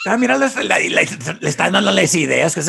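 put-on high, loud excited Spanish-accented voice; lively high character voice, switching into Spanish; mock Spanish-speaking character. Co-host acting out a movie character, high and loud.

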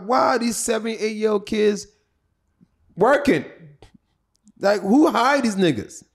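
high-pitched, incredulous questioning; indignant disbelief. Retold or imitated. Comic retelling his shock at village kids working, incredulous. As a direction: ask it in baffled protest.

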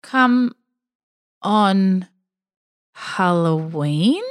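very slow, each word drawn out, Halloween? rising; slow puzzled repeat. Acted. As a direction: repeat it back slowly, baffled. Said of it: "He repeated her words back slowly".

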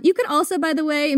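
ordinary talk

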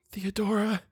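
very high, soft little call; frightened attempt to ask. A narrator's reading. Eleanor trying to reach Theodora, frightened hush. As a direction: barely get the name out. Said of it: "she tried to ask".

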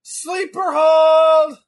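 drawn-out yelled call of the move; excited shout. Real, not acted. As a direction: yell it out long and slow. Calling out a wrestling move.

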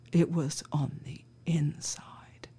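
slow, hushed reveal; dramatic whisper. Retold or imitated. Officer imitating the stoner revealing the feather clue, slow whisper. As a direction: whisper it slowly like a spooky twist.

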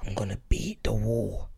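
low, unvoiced conspiratorial whisper; hushed secret plan. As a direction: whisper so mum behind the door can't hear. Dad whispers so mum won't hear.

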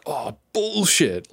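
praising a sick tune Aussie-style, slow and amazed; amazed Aussie praise. Shown as a demonstration. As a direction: drag it out like the tune blew your mind. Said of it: "you'd be like".

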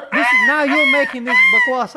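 high squealing laughing protest; shrieking laugh. Real, not acted. Friend laughing at the made-up chip-grabbing rule, squealing.

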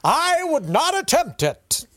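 hammy stage actor. Retold or imitated. Quoting champion pedestrian.

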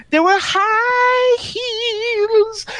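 very high, slow, theatrical croon; mock-serious rock star parody. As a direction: stretch it out in a pretentious falsetto. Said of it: "He quotes".

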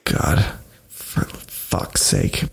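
slow, hushed, voiceless groan of dismay; weary exasperation. Real, not acted. Reacting to Jared Leto maybe joining the movie, exasperated mutter. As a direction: let it out slowly under the breath. Dreading the suggested casting.

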